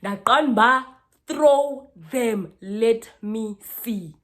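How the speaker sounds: loud, high taunt, drawn out; daring challenge. Retold or imitated. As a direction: dare her loudly, stretching the words. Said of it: "I literally told her".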